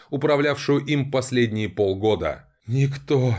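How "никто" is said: ordinary delivery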